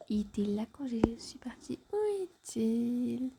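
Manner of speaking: hushed secretive whisper. Acted. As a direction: whisper so no one hears. Secretive search, be discreet.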